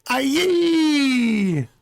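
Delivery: high piercing scream; terrified scream. Acted. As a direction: shriek in terror. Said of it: "Another scream filled the room".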